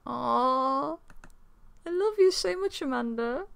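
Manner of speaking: high, slow mock sobbing; hammed-up crying. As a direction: wail it out in exaggerated tears. Daughter says love you, Dad.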